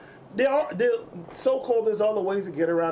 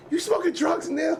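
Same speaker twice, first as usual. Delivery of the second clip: much higher accusing question; 11 semitones higher than usual. Retold or imitated. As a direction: confront dad, mock shocked. Confronting dad in story.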